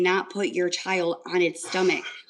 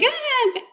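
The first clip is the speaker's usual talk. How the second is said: put-on sharp high baby gasp, much louder; imitated baby gasp cry; 4 dB louder than usual. Mother imitating her baby gasping back to breath. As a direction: yelp out a baby's first gasp for air.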